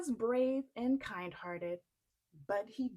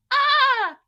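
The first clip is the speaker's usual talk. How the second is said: much higher, very loud cry; alarmed gasp; 18 dB louder than usual. Acted. Giantess hearing her husband coming, high loud.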